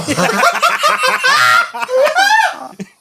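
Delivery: high shrieking belly laugh; hysterical laugh. Real, not acted. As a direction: lose it completely, shrieking laughter.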